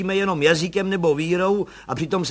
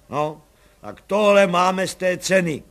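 cursing; 4 semitones higher, 6 dB louder than usual. A narrator's reading. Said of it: "nadával pan Bartošek".

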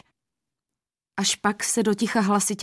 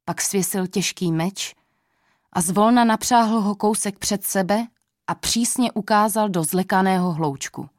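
plain reading voice

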